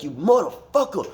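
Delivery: high-pitched imitation of a raging gamer; mock outburst, voice jumping up; retold angry snap. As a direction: act out a buddy losing it. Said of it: "And he snaps".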